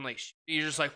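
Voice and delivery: usual speaking voice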